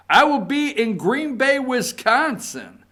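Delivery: hammed-up reading of the tweet; tweet read in character. Reading the president's tweet aloud.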